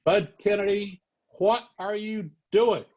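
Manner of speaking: high, drawn-out scolding; mock outraged telling-off; angry. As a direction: imitate an irate editor on the phone. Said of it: "was really angry".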